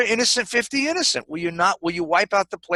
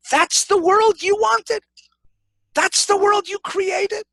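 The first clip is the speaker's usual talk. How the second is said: much higher, louder and slow; indignant challenge; 5 dB louder, slower than usual. Acted. Abraham confronting God over the world he made, indignant. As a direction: hurl the question up in disbelief.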